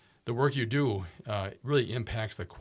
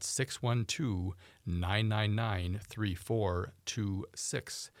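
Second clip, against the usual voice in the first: slow number dictation; much slower than usual. Real, not acted.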